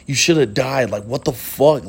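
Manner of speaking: ordinary talk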